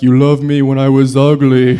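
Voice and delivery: loud, laughing car voice; the car speaks tenderly; lightly voiced loving car; car talking. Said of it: "It'll just be like".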